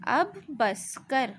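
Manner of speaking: slow, each word drawn apart; slow reading demo. Shown as a demonstration. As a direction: spell it out slowly for the children. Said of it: "ध्यान से सुनिए". (धीरे-धीरे, हर लफ़्ज़ अलग)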